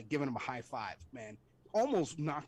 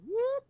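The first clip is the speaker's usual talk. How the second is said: mock whoop; 8 dB louder than usual. Imitating helicopter fleeing, laughing.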